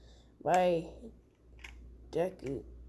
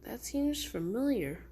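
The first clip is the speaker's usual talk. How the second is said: soft, hushed, then rising in disbelief; barely daring to believe it's him; stunned recognition; 4 semitones higher, 6 dB quieter than usual. Acted. Deku recognises his lost friend.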